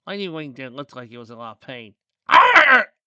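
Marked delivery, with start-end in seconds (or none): 2.3-2.9 high, very loud pained scream; acted yell of agony. A narrator's reading. Lightning Wing hurting his hoof, pained scream. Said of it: "he screamed".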